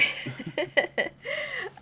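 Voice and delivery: a bright, high burst of real laughter. Real, not acted. Laughing at the speed-up remark, real high laughter. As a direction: laugh out loud at the joke.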